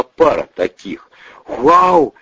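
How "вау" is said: excited wow. A narrator's reading. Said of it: "не удержался Бандура".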